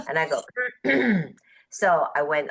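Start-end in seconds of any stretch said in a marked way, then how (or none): 0.5-1.3 awkward throat clear, acted out; mock throat-clearing. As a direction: clear the throat as a retold reaction. Acted reaction after "And I go.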